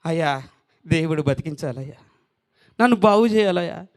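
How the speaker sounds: low, subdued imitation of a pleading believer; low-voiced mock begging; mock plea. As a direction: lightly act out a meek prayer to be healed. Acting a believer pleading to live.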